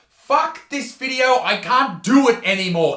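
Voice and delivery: loud fed-up rant; hammed-up meltdown; mock exasperation. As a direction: rant as if quitting in protest. Mock meltdown, quits the video.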